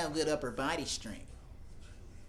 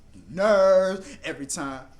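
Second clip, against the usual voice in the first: louder exasperated complaint; fed-up Diggle; 3 semitones higher, 10 dB louder than usual. Retold or imitated. Diggle complaining about his wife, louder exasperated. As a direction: vent like a fed-up husband. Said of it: "Diggle's gonna be like".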